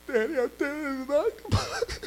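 imitating a frightened girl insisting; scared child. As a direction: daughter insists to daddy, tearful and scared. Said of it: "She's scared to death".

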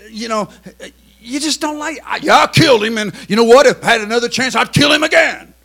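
loud, defiant killer voice. Retold or imitated. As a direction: spit it out like an unrepentant killer. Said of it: "and he says".

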